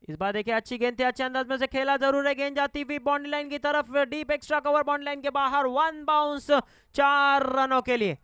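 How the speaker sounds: excited cricket commentary. Real, not acted. Boundary call builds.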